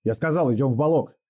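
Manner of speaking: high, angry command; angry shout. A narrator's reading. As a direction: snap the order angrily. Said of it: "злой окрик Пугачёва".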